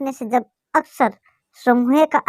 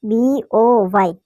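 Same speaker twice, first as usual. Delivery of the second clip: B, O, Y drawn out as separate letters; spelling out; much slower than usual. Shown as a demonstration. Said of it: "ये लिख रखा है ना". (B O Y एक-एक अक्षर अलग करके, धीरे)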